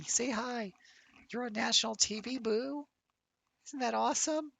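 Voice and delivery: high, sing-song voice for a cat; high cooing to the cat about being on TV; pet baby talk. Acted. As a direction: coo at the kitty like a toddler.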